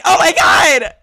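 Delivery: high, loud startled cry; startled yelp. Retold or imitated. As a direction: yelp as if grabbed by surprise. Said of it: "And I'm like".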